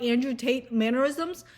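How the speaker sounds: ordinary talk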